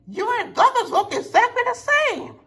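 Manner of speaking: very high, light little-engine voice; high Rosie voice. Acted. Rosie greeting Donald, mistaking him for Douglas, very high and light. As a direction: squeak it out like a small cheerful engine.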